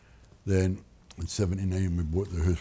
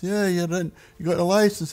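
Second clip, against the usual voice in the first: high, louder salesman voice; imitating Nick; 11 semitones higher, 6 dB louder than usual. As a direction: pitch it up like the pushy agent.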